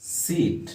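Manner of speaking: सीट with the ee vowel held long; long sound. Shown as a demonstration. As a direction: stretch सीट slowly and softly. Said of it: "इसी को long में बोला".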